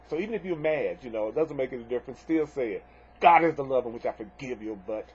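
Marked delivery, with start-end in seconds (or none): 3.2-5.1 grudging, annoyed. Shown as a demonstration. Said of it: "You don't have to necessarily say it in a really nicey, nicey way".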